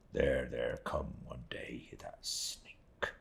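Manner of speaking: low, slow, hissed whisper of contempt; bitter whisper. A narrator's reading. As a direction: spit out "sneak" under your breath.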